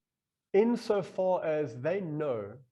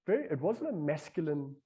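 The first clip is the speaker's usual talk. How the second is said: ordinary delivery, nothing marked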